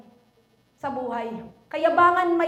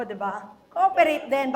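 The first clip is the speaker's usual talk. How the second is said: ordinary talk, nothing marked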